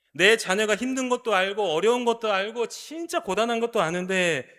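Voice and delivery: normal talking voice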